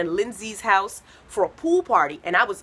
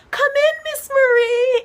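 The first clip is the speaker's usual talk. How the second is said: put-on very high, loud childlike calling; little kid voices; 7 dB louder, slower than usual. Toddlers calling their counselor into the pool, childlike. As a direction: chirp like small kids in the pool.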